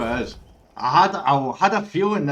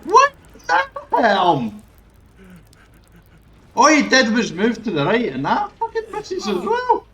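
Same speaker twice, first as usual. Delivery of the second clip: outraged yell; 8 semitones higher, 6 dB louder than usual. Real, not acted. Streamer protesting a missed hit, loud outrage. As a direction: let the outrage ring out.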